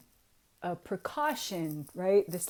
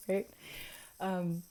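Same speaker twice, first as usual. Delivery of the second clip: usual speaking voice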